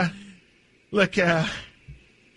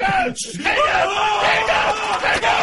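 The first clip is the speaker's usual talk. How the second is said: frantic repeated order; 10 semitones higher, much slower than usual. Acted. Owner yelling at teammate to hang up, building. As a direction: yell hang up, more urgent each time.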